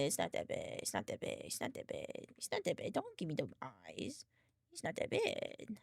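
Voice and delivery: soft whispered reassurance; hushed it's not that bad. Real, not acted. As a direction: whisper it to the cat. Whispering to cat.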